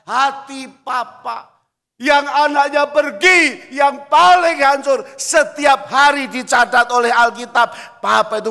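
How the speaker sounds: loud, high pulpit cry; loud, high preaching; full-force preaching. Acted. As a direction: call it out to the whole church.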